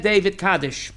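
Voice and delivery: ordinary delivery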